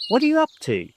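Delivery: high, loud curious question to cousin. A narrator's reading. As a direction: ask with bright suspicion. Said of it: "I asked her".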